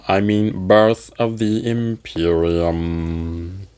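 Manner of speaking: a drawn-out, tired put-on greeting; slow sleepy host persona; weary slow host voice. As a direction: talk like it has been a long day, slowly.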